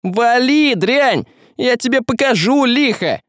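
high-pitched angry yell. Acted. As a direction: yell to drive the monster off. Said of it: "заорал Василий".

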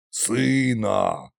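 low, drawn-out fatherly reproach; reproachful. A narrator's reading. Tsar father chiding his son, reproachful. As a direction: gently chide in a low voice. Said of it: "укоризненно покачал головой Кощей".

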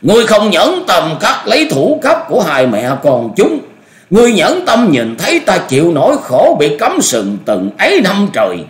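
high, loud furious tirade; enraged screaming. A narrator's reading. Betrayed old master berating his merciful junior, screaming. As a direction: rail in bitter outrage, pitch way up. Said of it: "rồi tức tối gào thét".

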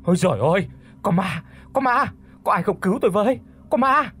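high, loud panicked shouts; terrified yelling. A narrator's reading. Man fleeing a ghost in his nightmare, terrified yell. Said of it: "rồi hét lên".

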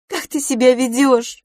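high, loud mock scolding; reproachful. A narrator's reading. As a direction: scold while holding back a laugh. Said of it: "укоризненно сказала мисс Лафос". (с укоризной, сдерживая смех)

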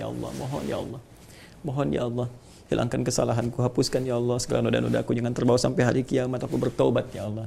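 soft repentant supplication; hushed prayer. Shown as a demonstration. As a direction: pray it under your breath, humbly. Voices a quiet personal prayer.

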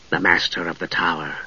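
low, soft hollow spectral voice; ghostly spectre voice. A narrator's reading. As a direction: speak like a cold ghost. Spectre speaks.

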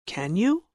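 "can you?" rises sharply at the end; rising tune on "can you?"; rising tag question. Shown as a demonstration. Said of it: "falling intonation or rising intonation".

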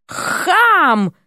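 high, sharp snarled insult; bare teeth and spit it; snarling. A narrator's reading. Серпентина snaps at the chubby man, snarling. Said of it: "оскалилась".

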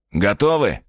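character voice. Acted. Vitya asks if ready.

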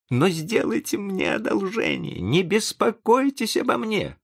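high-pitched agitated exclamation; exclaiming. A narrator's reading. Young man begs the professor not to trouble over him, exclaiming. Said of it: "воскликнул он".

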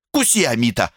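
высокая твёрдая команда псу; прикажи псу коротко и твёрдо; команда собаке. A narrator's reading. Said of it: "скомандовала Коколи псу". (твёрдая команда собаке)

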